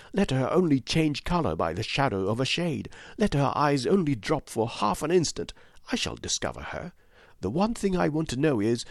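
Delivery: determined widow. A narrator's reading. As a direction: vow to catch her out. Voiced widow in the story.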